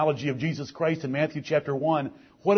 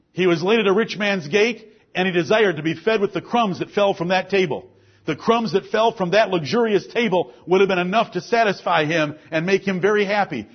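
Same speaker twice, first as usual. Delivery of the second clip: higher preaching voice, pressing on the story; preaching at full force; 7 semitones higher, 6 dB louder than usual. Acted. Preacher calling out the beggar's story, full force. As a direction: drive the beggar's story home.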